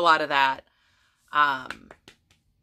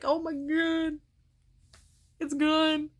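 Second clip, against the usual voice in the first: much higher, gushing delight; excited squeal; 10 semitones higher, much slower than usual. Retold or imitated. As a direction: squeal over the new haircut. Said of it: "it's like".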